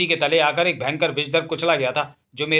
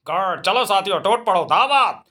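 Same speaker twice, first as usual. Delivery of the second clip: attack call; 4 semitones higher, 3 dB louder than usual. Acted. Hyena leader urging the pack to pounce, rallying howl. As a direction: rouse the pack for the feast.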